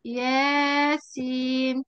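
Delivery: ياسين stretched very slowly, long aa; drawn-out demo word. Shown as a demonstration. Teacher giving examples to the class, very slow. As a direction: sound out ياسين with a long alif. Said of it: "كده صح؟".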